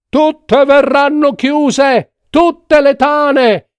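high, slow cry of alarm; shouted alarm. A narrator's reading. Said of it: "Subito qualcun altro gridò". (grido allarmato, acuto e lento)

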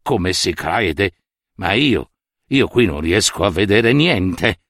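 a puzzled official muttering his doubts; doubtful minister. Acted.

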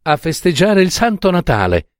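put-on girl announcing Christmas, delighted; exclaiming joyfully. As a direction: announce the celebration gleefully. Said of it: "esclamò Jenny".

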